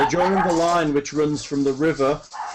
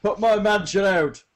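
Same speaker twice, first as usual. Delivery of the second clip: high-pitched lordly command; shouted order; 6 semitones higher, 3 dB louder than usual. Acted. Lord sending workers to the burning mansion, shouted order. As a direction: shout an urgent order across the grounds.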